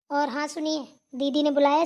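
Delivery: normal talking voice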